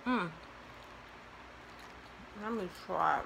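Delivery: talking between bites; muffled by food; chewing with mouth full. Real, not acted. Seen 1: Cheeks full and jaw working after eating fries, into early words.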